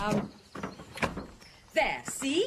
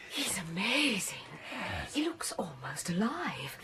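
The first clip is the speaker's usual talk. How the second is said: gasp, then a soft awed whisper; 5 semitones lower, 6 dB quieter than usual. Acted. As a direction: breathe it out in wonder. Awed at embalmed bishop in crypt.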